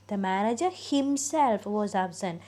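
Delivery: slow, with "himself" as the peak of the sentence; stress on himself. Shown as a demonstration. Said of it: "himself-ിനെ കുറച്ചും കൂടെ stress ചെയ്താണ്". (himself എന്ന വാക്കിൽ ഊന്നൽ)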